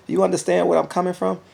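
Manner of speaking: usual speaking voice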